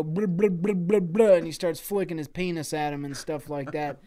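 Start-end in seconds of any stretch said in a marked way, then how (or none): 0.0-1.3 loud, silly repeated blubbering; taunting babble. Acted. Murdick taunting while flicking at him, silly babble. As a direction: babble mockingly in bouncy bursts.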